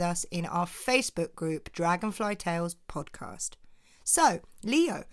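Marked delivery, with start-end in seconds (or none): none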